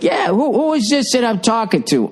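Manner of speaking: high, pushy stranger on the phone; a rude stranger demanding who's on the line; caller voice. Retold or imitated.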